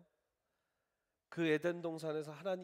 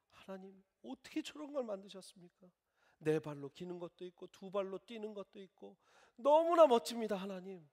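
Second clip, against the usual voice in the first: higher, eager wonder; delighted praise; 6 semitones higher, 6 dB louder than usual. Pretend. Adam thanking God for the animals, in eager wonder. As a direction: sing God's praises like a dazzled child.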